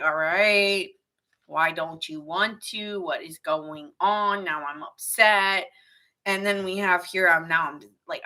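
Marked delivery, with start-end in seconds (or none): 0.0-5.7 slow, drawn-out mock complaint; mock frustrated partner. A partner left waiting in bed, slow mock sulk. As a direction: drag it out like a sulking partner at bedtime.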